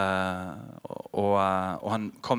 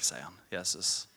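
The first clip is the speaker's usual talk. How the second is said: ordinary talk, nothing marked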